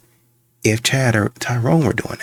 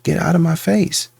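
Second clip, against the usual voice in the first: higher, exasperated push-back; woman at a bar tells a man to back off, annoyed; mock annoyed woman; 9 semitones higher than usual. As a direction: play the woman shoving a creep off. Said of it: "she's like".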